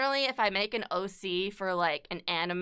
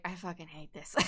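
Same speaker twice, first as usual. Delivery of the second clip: muttered disgust; 4 semitones lower, 13 dB quieter than usual. Retold or imitated. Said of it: "And I was like".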